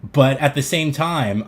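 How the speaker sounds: ordinary delivery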